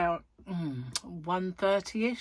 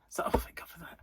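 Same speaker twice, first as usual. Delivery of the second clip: breathy sigh of relief; whispered relief; 3 dB quieter than usual. Real, not acted. Relief after reading at home.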